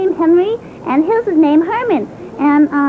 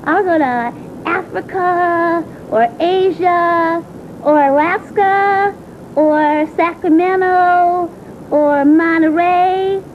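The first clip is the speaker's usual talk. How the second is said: dramatic vow. Acted. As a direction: stretch each far-off place. Child vows to run away.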